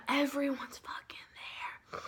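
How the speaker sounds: hushed for comic emphasis; whispered emphasis. Real, not acted. Listing the whole squad, whispered. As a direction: whisper everyone's fucking there, no voice.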